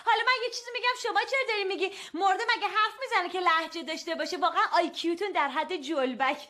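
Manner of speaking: high-pitched scolding through laughter; laughing scold. Acted. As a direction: tell them off but keep giggling.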